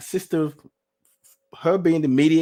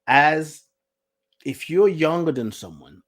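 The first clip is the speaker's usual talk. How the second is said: ordinary delivery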